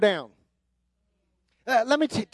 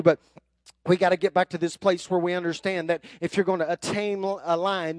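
normal talking voice

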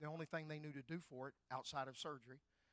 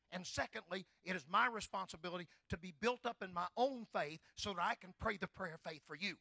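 raised, emphatic preacher's voice; preaching; 4 semitones higher, 7 dB louder than usual. Acted. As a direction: preach it out to the congregation. Preaching about his responsibility.

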